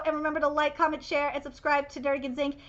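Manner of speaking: soft, quick channel sign-off; outro plug. Acted. Host plugging the channel to viewers, casual outro read. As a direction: rattle off the plugs casually.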